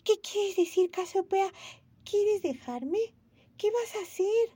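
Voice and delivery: put-on alarmed child afraid of losing her friend; frightened. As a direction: Momo scared the tortoise will leave. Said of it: "Momo se asustó".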